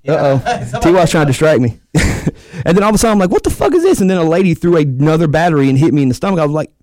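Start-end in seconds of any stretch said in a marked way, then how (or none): 3.3-4.0 high, startled mock yell; retold shocked yell. Wrestler retelling being hit by a battery, startled high yell. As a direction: voice jumping up in disbelief.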